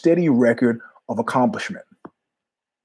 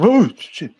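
startled; 7 semitones higher, 4 dB louder than usual. Retold or imitated. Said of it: "I turn".

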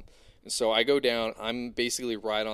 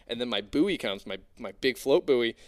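normal talking voice

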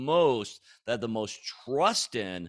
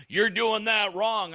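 a mock scold, higher and slow; 11 semitones higher, 4 dB louder, slower than usual. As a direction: mimic a nagging critic. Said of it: "I don't mean truth like".

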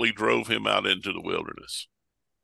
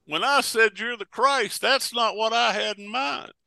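high-pitched protest as an alarmed disciple; acted-out high-pitched objection; Peter; 10 semitones higher than usual. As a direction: halt them with high, startled objections. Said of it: "this time it's Peter went".

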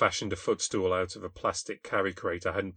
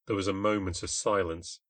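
ordinary delivery, nothing marked

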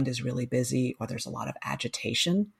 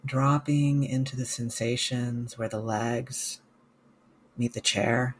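slow, calm meditation guide with long pauses; guided meditation; 2 semitones lower, slower than usual. Real, not acted. Mindfulness coach guiding listeners through a body scan, guided meditation. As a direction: guide listeners gently, leaving space after each phrase.

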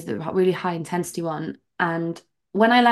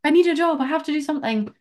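much higher, urgent frantic pleading; mock panic; 9 semitones higher, faster than usual. Imagining herself desperate for a job, mock panic. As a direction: act out a scramble for money.

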